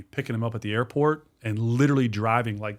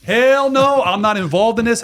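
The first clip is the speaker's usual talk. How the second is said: loud, high-pitched outraged refusal; salty refusal; 11 semitones higher, 7 dB louder, slower than usual. Retold or imitated. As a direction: belt it out like a salty old-school cop. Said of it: "he was salty dude".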